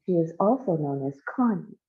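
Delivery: ordinary delivery, nothing marked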